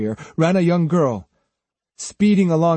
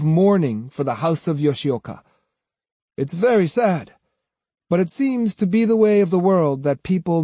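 higher, self-assured character voice; Kojiro warning; 6 semitones higher, 3 dB louder than usual. A narrator's reading. Swordsman warning the men of their master's defeat, self-assured. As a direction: lecture them with superior certainty.

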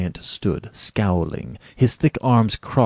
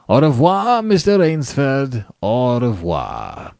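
theatrical farewell; 5 semitones higher, 6 dB louder, slower than usual. A narrator's reading. General taking leave of his quarry, theatrical. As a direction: sing out the goodbye with a flourish.

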